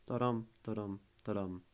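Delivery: da-dum with weight on every "dum"; iambic rhythm. Shown as a demonstration. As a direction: tap out da-dum, short then stressed. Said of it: "You would need an iamb".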